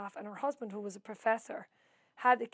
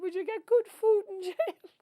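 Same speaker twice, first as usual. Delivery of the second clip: high, louder, slow mock-sob of despair; tearful wail; 10 semitones higher, 9 dB louder than usual. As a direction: wail it out like a self-pitying cry. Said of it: "and I'm crying into it".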